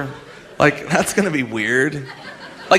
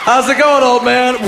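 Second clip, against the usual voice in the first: higher, louder mocking shout; kid shouting; 8 semitones higher, 5 dB louder than usual. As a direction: shout at the old man across the street. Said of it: "the guy's like".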